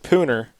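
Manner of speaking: Pooner with a long oo in the first syllable; spelling pronunciation. Shown as a demonstration. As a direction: read "Pooner" as it looks, oo as in moon. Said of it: "it almost looks like Pooner".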